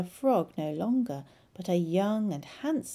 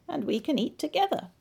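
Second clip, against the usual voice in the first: croaking frog; 8 semitones higher, faster than usual. Acted. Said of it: "he croaked".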